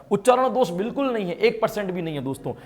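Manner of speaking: ordinary talk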